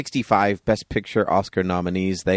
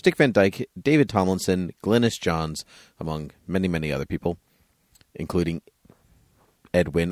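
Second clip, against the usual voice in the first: ordinary talk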